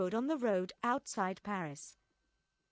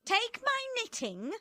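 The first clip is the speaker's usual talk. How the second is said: much higher, brisk order; commanding; 14 semitones higher than usual. A narrator's reading. Madame Defarge ordering Vengeance, high and curt. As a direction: hand it over curtly. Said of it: "Madame Defarge told Vengeance".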